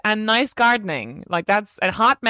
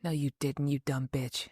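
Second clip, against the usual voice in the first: muttered retort; 7 semitones lower, 12 dB quieter than usual. Real, not acted.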